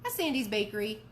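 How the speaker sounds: plain reading voice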